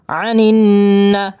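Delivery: "আনিন না" with a long hummed n before short "না"; chanted nasal hold, brief last vowel; ghunnah nasal hold. Shown as a demonstration. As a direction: linger on the doubled n of "আনিন". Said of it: "গুণা করে পড়ে নেবেন".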